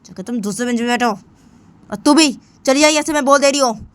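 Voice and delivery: furious yell. Acted. Simran after the slap yelling Sunaina away, furious and loud. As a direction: scream at her to go away.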